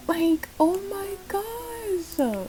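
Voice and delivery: high, slow gushing delight. Real, not acted. Vlogger gushing as the mom reacts, high and slow. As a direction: gush slowly in a high voice.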